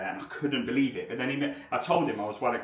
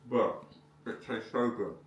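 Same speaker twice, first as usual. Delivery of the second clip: words round a bite of food; mouth full; 2 semitones lower, 4 dB quieter, slower than usual. Real, not acted. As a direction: still chewing as he talks. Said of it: "with my mouth full".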